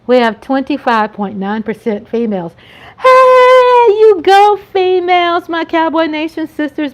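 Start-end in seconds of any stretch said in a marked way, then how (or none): 3.0-6.9 high, loud whoop easing down; cheering on. Real, not acted. Vlogger cheering female viewers, excited cheering. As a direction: whoop long and high, then cheer them on.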